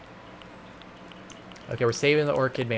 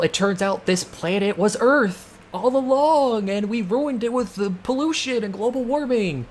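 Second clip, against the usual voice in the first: dramatic big reveal; 8 semitones higher than usual. Retold or imitated. Gamer retelling a Doctor Who plot, hammed-up high reveal. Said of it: "oh, big reveal".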